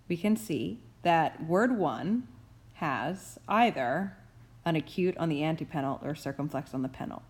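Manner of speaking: ordinary delivery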